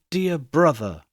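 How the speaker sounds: plain reading voice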